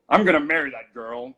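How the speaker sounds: booming, swaggering villain; Gaston voice. Retold or imitated. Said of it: "Gaston sort of quality of voice".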